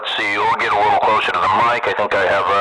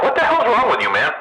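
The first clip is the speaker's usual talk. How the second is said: exasperated; 12 semitones higher than usual. Retold or imitated. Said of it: "I'm like".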